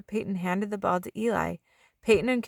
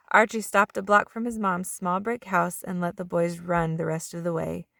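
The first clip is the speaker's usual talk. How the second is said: ordinary delivery, nothing marked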